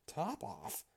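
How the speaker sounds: high, questioning echo of TOP OFF; surprised query. A narrator's reading. Mouse querying the cat's odd name, mildly surprised. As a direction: repeat it as a startled question. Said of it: "cried the mouse".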